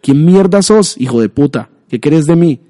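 desperate high yell; angry terrified yell. Acted. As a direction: shout it, scared and furious. Kevin yells at caller. (grito furioso y aterrado)

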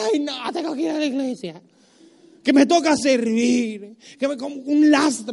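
mock whine about going to church; whiny churchgoer. Preacher mocking lazy churchgoers, whiny. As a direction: moan like a reluctant churchgoer.

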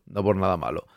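hushed aside. Real, not acted. To chat, repeating his reassurance as a hushed aside. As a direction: drop it way down, almost to yourself.

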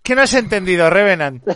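hammed-up scolding of an opponent; mock indignant Dallas. Streamer mimicking Dallas confronting Revenant, mock indignant. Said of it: "Se pone así".

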